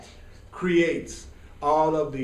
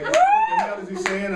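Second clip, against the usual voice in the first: high, loud mock confused audience member; hammed-up baffled audience voice; puzzled listener; 4 dB louder than usual. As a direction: squeak out the bafflement of a lost listener. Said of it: "Some of y'all like".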